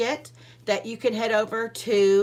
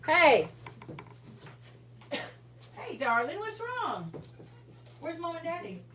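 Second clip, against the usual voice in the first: high, soft comforting coos to a little one; 10 semitones higher, 6 dB quieter than usual. Real, not acted. Mother soothing her choking baby: soft, high and tender.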